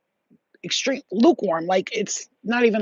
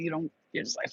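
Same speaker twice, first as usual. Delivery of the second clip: ordinary delivery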